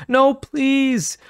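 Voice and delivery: high, loud desperate plea; begging. Acted. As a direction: beg for mercy. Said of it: "Noah begged".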